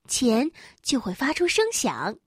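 usual speaking voice

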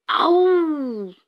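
high, drawn-out "awoo"; bear's scare roar. A narrator's reading. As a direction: howl out to frighten someone. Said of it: "熊也跳了出来".

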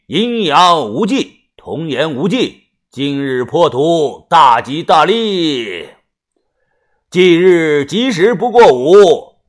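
slow, drawn-out burial incantation; loud ritual recitation. A narrator's reading. Mr. Song recites the burial rite at the grave, loud chanting. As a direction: intone each phrase solemnly, stretching the last word. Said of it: "宋先生大声地念道".

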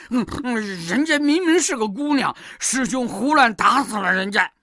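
huffy. A narrator's reading. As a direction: complain indignantly with huffing grunts. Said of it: "气呼呼地对唐僧说". (猪八戒气呼呼地告状)

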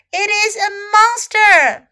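high, loud storybook cry; frightened scream. A narrator's reading. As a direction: shout in panic, as a narrator would. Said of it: "他尖叫了起来".

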